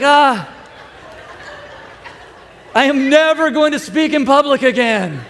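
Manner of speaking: joking despair about never speaking again; mock despair. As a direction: groan it out as a comic defeat. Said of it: "I was just like".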